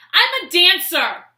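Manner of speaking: slow, loud proud declaration; blurted reply. Retold or imitated. To the shocked old man, loud slow boast. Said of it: "And I go".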